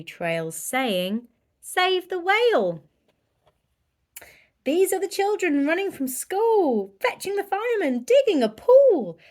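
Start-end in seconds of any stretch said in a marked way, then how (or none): 1.6-2.8 much higher, louder rallying cry; urgent call. A narrator's reading. Trail message. 4.6-9.2 much higher, louder, rushing; excited narration. A narrator's reading. Excited children rush.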